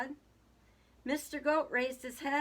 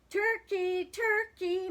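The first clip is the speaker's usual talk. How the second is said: high, loud sweet call; high, sugary calling; sweetest voice; 10 semitones higher, 6 dB louder, slower than usual. A narrator's reading. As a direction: coax him out with a sing-out call. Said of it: "called in his sweetest voice".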